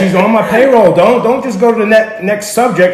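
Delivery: loud, high, laughing; laughing insistence. Real, not acted.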